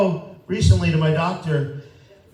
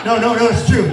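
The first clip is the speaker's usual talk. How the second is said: insisting; 6 semitones higher, 5 dB louder than usual. Real, not acted. Insisting to doubting audience.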